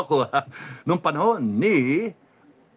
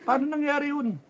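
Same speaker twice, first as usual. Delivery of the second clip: mock disbelief; 12 semitones higher, faster than usual. Mocking disbelief at results.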